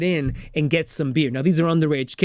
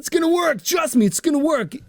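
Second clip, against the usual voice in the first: much higher, a little louder, eager buddy voice; friend's eager voice; 10 semitones higher, 4 dB louder than usual. Retold or imitated. Stiles reassuring Scott, much higher eager voice. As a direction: pitch it way up like a cocky friend.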